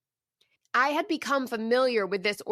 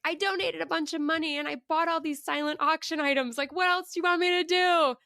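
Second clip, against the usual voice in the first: high-pitched protesting plea; negotiating; 5 semitones higher than usual. Retold or imitated. Woman bargains with God over her donations, pleading protest. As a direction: list your good deeds in a retold bargaining whine. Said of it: "negotiating with God".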